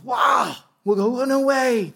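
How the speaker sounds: high-pitched, awestruck disbelief; acted amazement. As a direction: react as if astonished by a marvel. Acts out amazed reaction to light.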